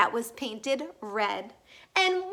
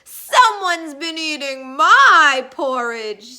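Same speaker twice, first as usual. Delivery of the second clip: Papa Bear; 8 dB louder than usual. A narrator's reading. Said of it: "said Daddy Bear".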